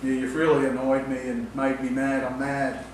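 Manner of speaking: unconvincing angry claim, dead level; deadpan fake anger. Shown as a demonstration. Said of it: "if I speak like that".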